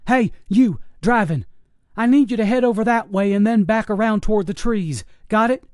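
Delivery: high, loud commanding yell. Acted. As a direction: shout orders into the cab. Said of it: "He yelled in the cab".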